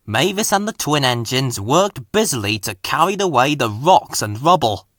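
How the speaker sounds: ordinary delivery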